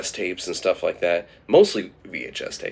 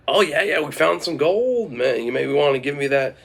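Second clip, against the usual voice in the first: higher, louder, eager offer; eager boast; 7 semitones higher, 4 dB louder than usual. Acted. Farmer bragging to a seller about found gold, eager. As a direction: pitch it up with excitement. Gary haggles with the goblin.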